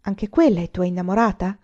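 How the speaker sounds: plain reading voice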